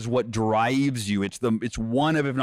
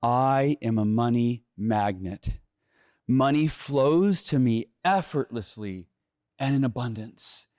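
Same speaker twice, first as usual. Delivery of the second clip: slow, dreamy mock affirmation; slow hammed-up daydream of easy money; 2 semitones lower, slower than usual. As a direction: drift through it like a blissed-out guru. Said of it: "I need to do my affirmations".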